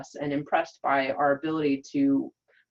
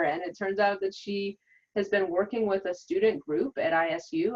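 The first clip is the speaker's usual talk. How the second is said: ordinary talk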